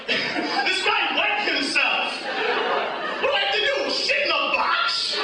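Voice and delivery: high whiny complaining voice; whiny rival. Retold or imitated. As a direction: squeal it like a sore loser. Science fair loser complains.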